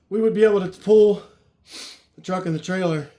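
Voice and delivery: panting through the words; winded from lifting totes; out of breath. Real, not acted. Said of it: "Man, I'm out of breath".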